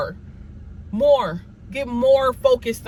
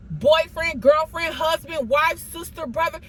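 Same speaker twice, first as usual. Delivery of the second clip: ordinary talk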